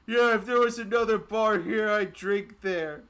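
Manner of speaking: high, loud heckle from afar; shouted retort. Acted. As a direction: call back defiantly across the street. Thrown-out man calls back from next building.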